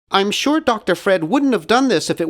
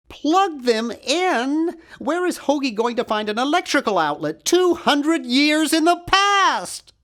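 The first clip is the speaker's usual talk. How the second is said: incredulous; 9 semitones higher than usual. Acted. Incredulous at doctor's plan.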